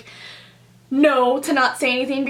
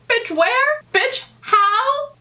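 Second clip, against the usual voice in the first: high, rising, sassy disbelief; incredulous; 11 semitones higher, much slower than usual. Retold or imitated. Woman answering the couple's proposition, incredulous. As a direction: pitch each question up in mock outrage. Acts out outraged reply to proposition.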